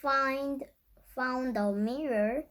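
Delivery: usual speaking voice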